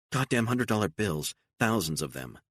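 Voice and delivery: plain reading voice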